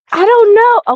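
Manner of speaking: high, laughing shrug; real giggly deflection. Real, not acted. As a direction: laugh through the words. Guest laughs about her accent.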